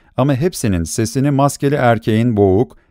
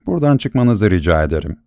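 ordinary delivery

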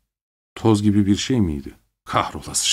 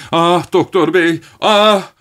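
higher, louder, slow anguished cry; suddenly loud wail; 9 semitones higher, 4 dB louder, slower than usual. A narrator's reading. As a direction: burst out in despair. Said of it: "Birden yükseltmişti sesini". (birden yükselen acılı feryat)

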